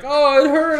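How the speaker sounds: hammed-up complaint from the brother; mock whine. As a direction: whine it like a hurt kid. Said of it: "and he's like".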